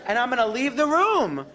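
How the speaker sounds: ordinary talk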